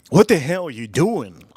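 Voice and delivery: ordinary delivery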